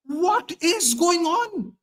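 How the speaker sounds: high, drawn-out disbelief; retold exasperation. Fan reliving the ninetieth minute, slow high exasperation. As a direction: stretch out each word in incredulous protest. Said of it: "I was so mad".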